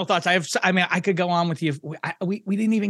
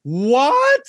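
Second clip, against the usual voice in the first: high, loud, slowed double take; can't believe what he just heard; surprised disbelief; 10 semitones higher, 4 dB louder, much slower than usual. Real, not acted. Reacts to siren sounder news.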